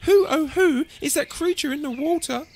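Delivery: high, wide-eyed wonder; curious little dinosaur; wondering question. A narrator's reading. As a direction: ask it in an awed high voice. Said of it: "he asked them".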